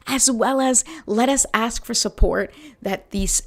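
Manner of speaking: ordinary talk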